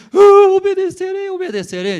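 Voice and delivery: put-on high, loud frightened child voice; pleading child. Child answering his angry father, high frightened pleading. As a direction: beg like a scared little boy.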